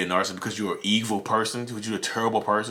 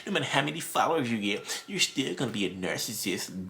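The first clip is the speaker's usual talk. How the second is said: a light sneering voice for the critics, softer; mocking the haters; 4 semitones higher, 5 dB quieter than usual. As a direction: mimic a smug hater, lightly.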